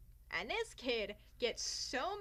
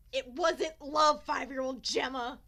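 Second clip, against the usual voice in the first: exasperated scolding; 9 dB louder than usual. Real, not acted. Scolding her younger self.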